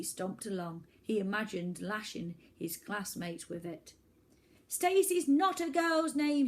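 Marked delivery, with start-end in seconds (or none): 4.7-6.5 high, loud, slow angry shout. Acted. Lonely boy shouts at the beach, high, loud, slow. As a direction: yell it at the empty beach. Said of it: "he shouted".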